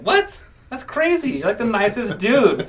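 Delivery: squeaky high what, then rushed high protest; shocked disbelief. Retold or imitated. Retelling telling a coworker he's nice, in shock. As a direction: pitch way up in stunned disbelief. Shocked reply to friend's confession.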